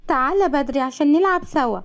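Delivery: put-on high-pitched child's voice; small, high, pleading child; little child. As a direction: ask dad to come play, like a small kid. Child asks father to play.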